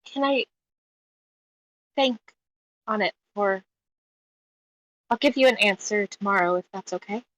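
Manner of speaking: halting request, trailing off very softly; tearful, hesitant. Acted. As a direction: ask haltingly while holding back tears. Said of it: "at this point, tears are slightly falling".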